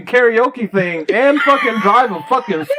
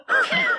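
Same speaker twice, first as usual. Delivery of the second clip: sharp gasp; 7 dB quieter than usual. Retold or imitated. Kid freezing over his hot dog, sharp gasp. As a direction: gasp like a kid mid-bite. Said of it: "looks like".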